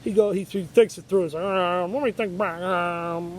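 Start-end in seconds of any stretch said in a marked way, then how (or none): 1.4-3.4 a lightly put-on demonic voice for the ex; hint of a creepy demon man thinking back. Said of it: "the weirdest demonic voice I've ever heard".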